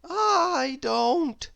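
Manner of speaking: high, mild moan. A narrator's reading. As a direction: whimper it, rubbing a sore head. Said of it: "moaned little Miss Brainy".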